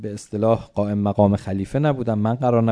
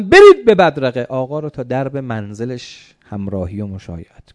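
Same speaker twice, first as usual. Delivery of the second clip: shouted command; 8 dB louder than usual. Acted. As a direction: cry out the order at full voice. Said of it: "باز پدرم هارون فریاد زد". (فریاد آمرانه و بلند)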